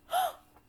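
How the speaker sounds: loud high gasp; shocked gasp. Acted. Ant sees alligator.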